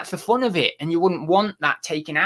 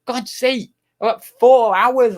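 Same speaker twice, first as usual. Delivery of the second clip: exasperated shout; 8 semitones higher, 6 dB louder, slower than usual. Real, not acted. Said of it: "Everyone's moaning".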